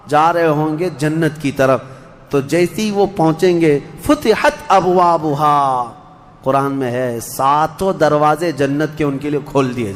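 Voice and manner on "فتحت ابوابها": slow chanted Arabic phrase; Quranic recitation. Acted. Preacher reciting how paradise's gates open, slow and chanted. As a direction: intone it slowly.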